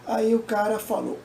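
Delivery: normal talking voice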